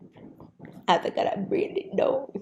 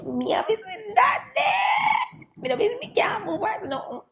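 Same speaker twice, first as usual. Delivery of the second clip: rising, loud, high Jamaican patois rant ending in a crying shout; furious Jamaican patois rant to God; upset Jamaican patois rant; 6 semitones higher, 6 dB louder than usual. Real, not acted. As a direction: vent in Jamaican patois until the voice breaks high.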